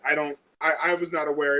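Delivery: ordinary talk, nothing marked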